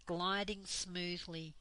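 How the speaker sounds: usual speaking voice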